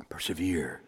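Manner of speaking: soft whispered urging. Real, not acted. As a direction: whisper it intently. Whispered exhortation.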